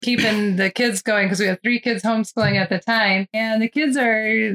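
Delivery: normal talking voice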